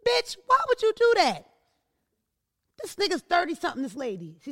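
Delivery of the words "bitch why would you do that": high, loud indignant cry; retold outburst of disbelief; outraged reaction. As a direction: call her out in high-pitched disbelief. Acted outraged reply to the woman.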